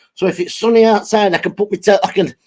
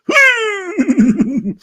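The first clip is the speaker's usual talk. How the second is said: high giggle; 11 semitones higher than usual. Real, not acted. High laugh.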